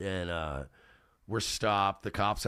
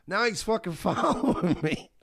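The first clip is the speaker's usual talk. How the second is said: laughing mid-line; 10 semitones higher, 4 dB louder than usual. Retold or imitated. Teller cracks up while joking.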